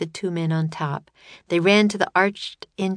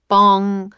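mock bell bong; 3 semitones higher, 7 dB louder than usual. A narrator's reading. Clock striking.

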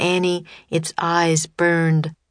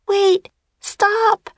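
put-on very high urgent child's shout; two separate high cries to halt it; 3 dB louder than usual. A narrator's reading. Annie halting the attacking serpent, shouting. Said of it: "Annie shouted at the sea serpent".

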